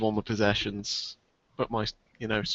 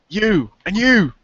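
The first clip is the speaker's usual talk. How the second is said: loud, high shout at each person; yelling across the room; 7 semitones higher, 9 dB louder, much slower than usual. Acted. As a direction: call them out across the room. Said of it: "I just shout across the room".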